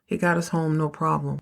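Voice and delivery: normal talking voice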